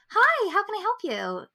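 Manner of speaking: high-pitched, feminine customer-service greeting. Retold or imitated. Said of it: "in my very feminine voice".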